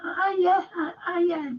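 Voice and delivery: high, breaking hiya, flustered and overwhelmed; answering the greetings. Retold or imitated. Frank answering loads of greeters, high flustered hiya. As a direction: squeak back hiya to everyone at once. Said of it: "I'm like".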